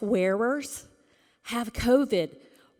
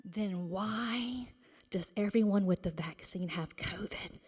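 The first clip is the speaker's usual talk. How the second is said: dramatic hushed aside; sudden whisper; 5 dB quieter than usual. Real, not acted. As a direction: drop to a breathy conspiratorial whisper.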